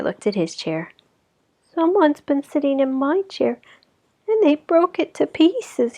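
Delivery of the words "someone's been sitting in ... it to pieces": high, upset little cub; baby bear crying out. Acted. Baby Bear finds his chair broken, high and upset. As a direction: cry it like a small hurt child. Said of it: "he cried".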